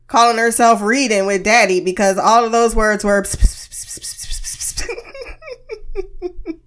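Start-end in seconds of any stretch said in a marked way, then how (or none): 3.2-6.7 laughing. Real, not acted. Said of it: "when I laugh, you can hear it".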